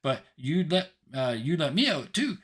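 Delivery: put-on high, loud little-girl call; tiny girl yelling out. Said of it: "and she yells out".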